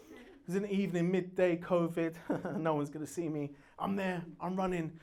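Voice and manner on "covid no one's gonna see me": usual speaking voice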